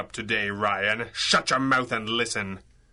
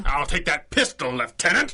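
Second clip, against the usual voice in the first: commanding entrance; 4 semitones higher, 3 dB louder than usual. Acted. Hero bursts in on villain.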